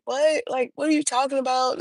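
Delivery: high, fast disbelieving squeak; shocked. Retold or imitated. Girlfriend startled by the cop's hint, high and fast. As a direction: jump way up in pitch, startled and defensive.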